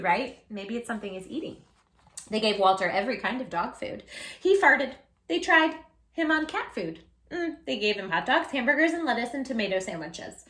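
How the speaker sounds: plain reading voice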